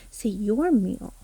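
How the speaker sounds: ordinary talk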